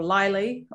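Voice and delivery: Lily said as "LIE-lee"; Lily rhyming with highly, rising at the end; mispronounced variant. Shown as a demonstration. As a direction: say Lily with a long "eye" vowel. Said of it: "I've heard Lily is an obvious one".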